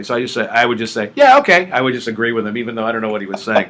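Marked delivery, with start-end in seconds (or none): 1.1-1.7 feigned agreement. Retold or imitated.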